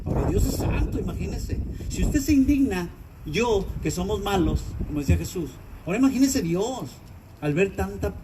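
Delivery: usual speaking voice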